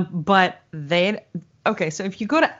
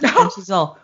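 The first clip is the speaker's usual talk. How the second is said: usual speaking voice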